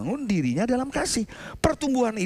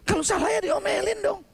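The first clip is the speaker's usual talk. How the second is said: preaching shout; 11 semitones higher than usual. Real, not acted. Preacher drives home his point.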